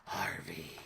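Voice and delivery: hoarse whispered hero voice; Batman gravel voice. Retold or imitated. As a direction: rasp it like the Bat. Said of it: "He talks like that 'cause he's got them gravelly".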